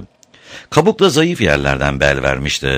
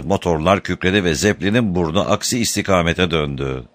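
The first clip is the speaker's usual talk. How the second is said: usual speaking voice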